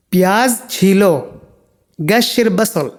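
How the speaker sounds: ordinary talk, nothing marked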